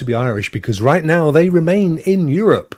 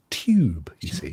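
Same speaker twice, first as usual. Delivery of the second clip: normal talking voice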